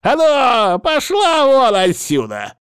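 put-on high screeching old man yell; furious shout. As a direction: bark it at her in rage. Healer drives her out.

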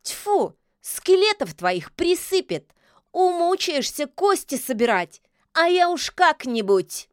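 high, slow witch's scoff; high, loud, unimpressed jeer; scornful retort. Acted. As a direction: spit it back, drawing words out. Witch scoffs back at villain.

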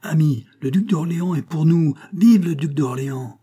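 loud, high rallying proclamation; cried cheer. A narrator's reading. Billaud telling friends the duke is theirs, loud rallying cry. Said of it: "cria Billaud".

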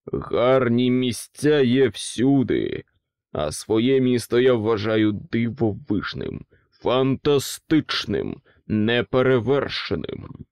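slow, booming, building boast; roaring king. A narrator's reading. King corrects the pig, slow booming boast. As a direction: roar the praise of his city. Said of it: "гучно проривів останнє слово".

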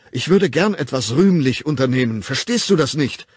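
loud, high, fervent pleading; exasperated, ardent declaration; impassioned insistence. A narrator's reading. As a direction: make them understand your noble urge. Impassioned plea to be understood.

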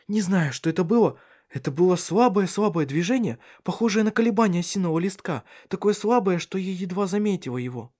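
high, agitated woman's voice; excited wonder. A narrator's reading. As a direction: tell it high, full of amazement. She describes the first movement. (взволнованно, высоким голосом)

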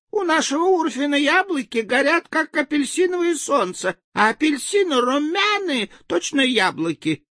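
very high, thin sing-song; tiny villager piping sweetly; high little voice. Acted. Continues same high voice.

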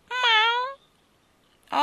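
high, drawn-out meow; cat meow. A narrator's reading. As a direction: mew loudly like a cat. Narrator voices the cat's meow. (miagolio acuto)

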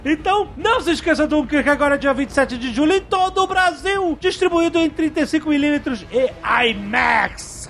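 fast, upbeat promo voice; ad read. Acted. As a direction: sell it like a trailer announcer. Ad read for the film.

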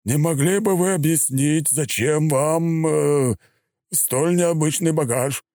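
careful, hesitant, slow; forced calm. A narrator's reading. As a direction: keep the voice level under strain. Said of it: "держа голос ровным".

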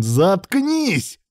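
high, sharp bark; barked command. A narrator's reading. Man looming over his partner cuts him off, barked command. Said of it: "рявкнул Илья".

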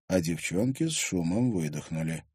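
usual speaking voice

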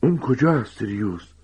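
urgent, high-pitched question that drops at the name; intensely excited. Acted. Lupin demands to know where someone is, intensely excited. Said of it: "حالت عجیبی حاکی از هیجان شدید".

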